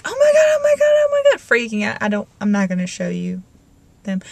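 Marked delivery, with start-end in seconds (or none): 0.0-1.4 high, loud mock freak-out; frantic quote. Vlogger mimicking a panicked stranger, high and loud. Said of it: "That person was like".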